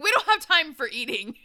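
loud, high, impatient order; bossy shout. Acted. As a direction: bark it out like a drill sergeant. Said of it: "She's like".

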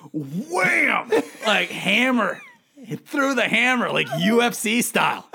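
loud, high, dragged-out wham; pained dad shouting about the punch; excited retelling. Retold or imitated. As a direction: relive the hit with a shout.

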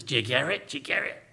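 imitating the teacher's English accent, fading to a breath. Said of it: "that's a good traditional English accent for you".

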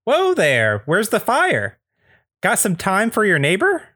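high, folksy neighbor voice; Andy Daly. Acted. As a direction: stop them with high-pitched neighborly chumminess. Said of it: "is played by Andy Daly".

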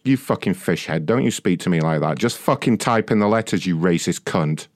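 quiet seething anger; low menacing threat. Real, not acted. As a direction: growl it with cold fury.

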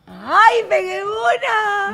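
high, loud drawn-out cheer; real burst of delight, stretching the last word; excited triumph. Real, not acted. Learner who finally guessed one, excited triumph.

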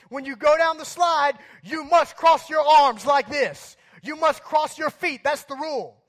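loud, high, domineering orders; drill-sergeant bark. Retold or imitated. Lifeguard laying down slide rules, barking loudly. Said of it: "barking out orders like a drill sergeant".

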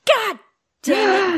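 frustrated groan. Retold or imitated. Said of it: "I was like".

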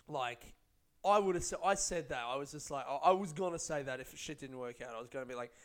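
usual speaking voice

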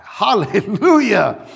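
laughing, drawn-out hallelujah; thankful laughing praise; joyful praise. Retold or imitated. Praising for the money.

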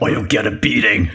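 mild mock threat; lightly voiced bully threat; slow theatrical villain menace. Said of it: "the voice of a villain".

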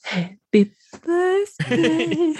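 slow, high, sultry birthday song voice; breathy Marilyn Monroe. Retold or imitated. Joking about Kennedy, slow high sultry Marilyn voice. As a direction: purr it out slowly like a screen siren. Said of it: "all I thought was".

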